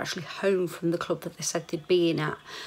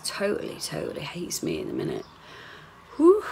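ordinary delivery

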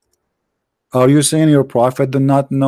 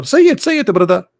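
goading; 5 semitones higher than usual. Real, not acted. Goading opponent to say it.

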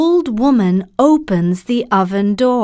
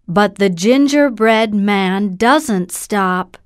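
ordinary delivery, nothing marked

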